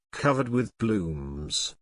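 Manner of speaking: ordinary delivery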